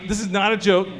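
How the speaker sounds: ordinary delivery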